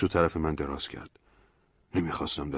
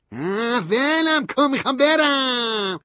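high, slow, loud protest; Stanley pulling free; 6 dB louder, slower than usual. A narrator's reading. Stanley wants narrator to let go, loud high protest. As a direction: shove him off with a groan.

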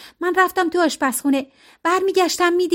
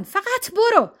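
higher, firm 'فقط برو' with falling end; cold order to leave; 8 semitones higher, slower than usual. A narrator's reading. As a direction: order them out, held in. Serena orders them out.

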